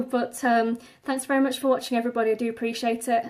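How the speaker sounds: ordinary talk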